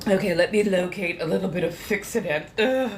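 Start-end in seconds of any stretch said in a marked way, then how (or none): none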